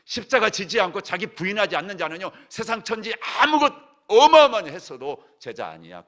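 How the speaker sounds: full-voiced, high preaching cry; preaching shout. Real, not acted. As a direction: hammer it out at the top of your voice.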